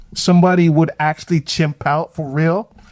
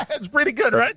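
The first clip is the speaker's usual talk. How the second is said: laughing; 6 semitones higher, 6 dB quieter than usual. Real, not acted. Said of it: "Is that your best German accent?".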